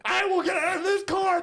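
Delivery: very high, loud strained wail; hammed-up desperate defiance; mock threat. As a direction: shriek it like a helpless, hysterical passenger. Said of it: "I was threatening".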